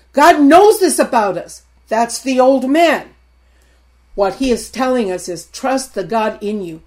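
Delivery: usual speaking voice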